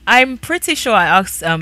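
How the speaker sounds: ordinary delivery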